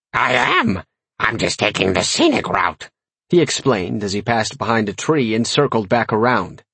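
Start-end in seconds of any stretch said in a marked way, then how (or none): 0.1-2.9 croaky demon making an excuse; raspy imp voice. A narrator's reading. Imp circling his master explains himself, raspy croak. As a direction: rasp out the excuse like a little imp. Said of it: "croaked in its raspy, high-pitched voice".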